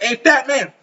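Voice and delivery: mock high-pitched demand; German man. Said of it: "German man sitting up to you like".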